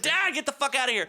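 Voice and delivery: high, quick telling-off; retold exasperation. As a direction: re-enact shooing an embarrassing dad away. Retold snapping at dad.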